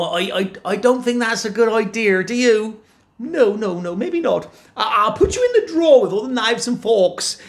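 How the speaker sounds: high, dithering wife voice; flustered giantess. Acted. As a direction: fuss over where to hide him. Wife frets where to hide.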